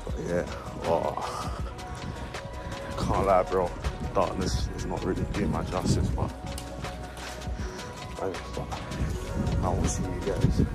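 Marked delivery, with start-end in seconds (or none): none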